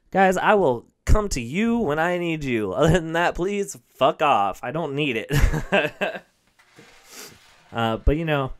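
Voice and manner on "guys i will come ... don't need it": slow, louder, dismissive; blunt brush-off. Retold or imitated. Comic to loan callers, slow loud brush-off. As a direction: drag out the brush-off. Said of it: "I was like".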